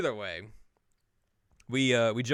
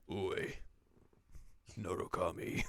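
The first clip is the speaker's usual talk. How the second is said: low, soft gruff call; a touch of a tough guy; gruff yakuza voice; 4 semitones lower, 9 dB quieter, slower than usual. Retold or imitated. Kiryu calling out, low gruff voice.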